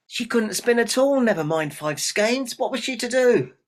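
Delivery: high, loud storyteller's outcry; dramatic dismay. A narrator's reading. Narrator dramatizes the girl's despair.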